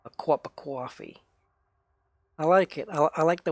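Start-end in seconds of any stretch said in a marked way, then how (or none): 0.0-1.2 New York "cwop a cwoffee"; New York accent. Shown as a demonstration. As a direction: imitate New York coffee with a slipped-in W. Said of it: "it is New York".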